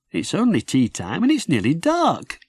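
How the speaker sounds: put-on high, puzzled little-boy voice; a small child wondering aloud; small boy Jim. Little Jim asks his sister why it's dark, high boy's voice. Jim's voice in story.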